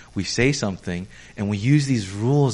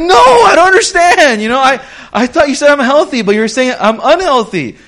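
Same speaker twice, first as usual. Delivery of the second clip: much higher and louder, bewildered; confused protest; 11 semitones higher, 10 dB louder than usual. Retold or imitated. Pastor plays himself baffled by a hidden insult. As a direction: cry out in total confusion.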